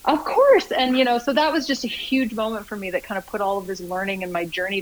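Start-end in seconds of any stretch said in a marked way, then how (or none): 0.0-0.7 a sudden high, loud "of course"; emphatic agreement. Real, not acted. Guest agreeing eagerly with the podcast host, emphatically. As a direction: exclaim it as if it's obvious.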